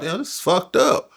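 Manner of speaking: high strained dismay. Retold or imitated. Young inmate reacting to cellmate's death warrant, high dismay. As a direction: push it up high, overwhelmed. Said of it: "it was like".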